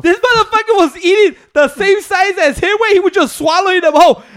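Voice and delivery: loud, amazed retelling; excited. Real, not acted. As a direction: raise it up in disbelief.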